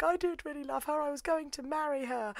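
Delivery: high, soft, dazed put-on voice; balmy Yuki. Concussed Yuki rambling to Toin in a high, soft, dazed voice. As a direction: murmur it like someone who's lost it. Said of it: "he's going a little bit balmy".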